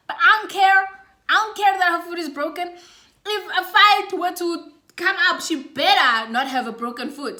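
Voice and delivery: loud, hyped-up bravado; fired up. Retold or imitated. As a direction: pump yourself up for the fight. Fired up, recalling the fight.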